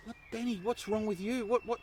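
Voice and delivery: high-pitched imitation of an anxious mother; worried mum. Mum alarmed, voiced by son.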